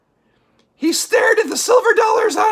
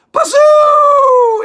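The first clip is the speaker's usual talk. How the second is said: loud, higher drawn-out howl; wind howl; 5 semitones higher, 7 dB louder than usual. A narrator's reading. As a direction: wail like a storm wind. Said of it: "It went".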